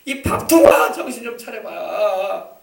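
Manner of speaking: angry parent shouting. Retold or imitated. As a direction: yell at a disobedient child. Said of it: "화가 나잖아요".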